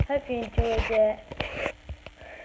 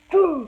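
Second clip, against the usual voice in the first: very loud booming mock sneeze; Big Bertha sneeze; big fake sneeze; 3 semitones higher, 9 dB louder than usual. Shown as a demonstration. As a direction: let rip a huge sneeze. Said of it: "here's the Big Bertha sneeze".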